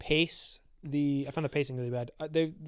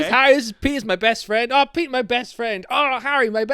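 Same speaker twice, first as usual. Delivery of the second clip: mocking game dialogue; 6 semitones higher, 4 dB louder than usual. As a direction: parody the cheesy best-friend lines. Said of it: "nobody fucking talks like this".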